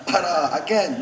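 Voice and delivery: usual speaking voice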